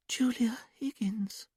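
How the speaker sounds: high, slow, whispered dreamy voice; trance whisper. A narrator's reading. Julia in trance answering Dominic, high childlike whisper. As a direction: breathe it out far away, like a child.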